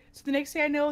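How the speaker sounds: ordinary talk, nothing marked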